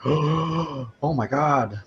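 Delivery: big gasp, then a high, drawn-out cry; hammed-up slow, high alarm; over-exaggerated panic. Augur grabbing the shaking walls, melodramatically panicked. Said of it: "totally is over-exaggerating it".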